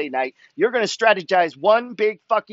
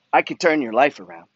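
ordinary talk

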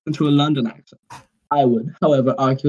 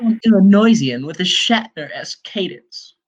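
high, drawn-out theatrical read; mock tryhard quote; 6 semitones higher, 3 dB louder, slower than usual. Reading out a tryhard's comment to friends, mock-theatrical. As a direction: put on a tryhard's grand delivery. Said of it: "a tryhard who goes".

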